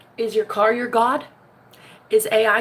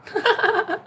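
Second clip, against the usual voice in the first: high giggle; 12 semitones higher than usual. Real, not acted.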